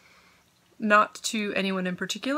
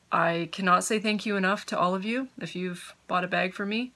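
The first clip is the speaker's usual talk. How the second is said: normal talking voice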